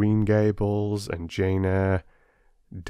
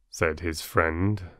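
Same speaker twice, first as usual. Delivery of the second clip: plain reading voice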